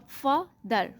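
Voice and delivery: फ़ा दर as two separate syllables; split into syllables. Shown as a demonstration. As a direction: break it apart, फ़ा, then दर. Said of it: "फ़ा को आप अलहदा कर दें".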